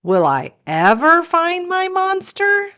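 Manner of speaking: plain reading voice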